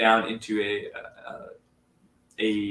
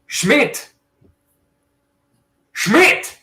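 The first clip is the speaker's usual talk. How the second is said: loud call into the building; yelling a name; 12 semitones higher, 10 dB louder than usual. Acted. Greg hollering into the dark building. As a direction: holler for Schmidt through the house. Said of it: "he yells through".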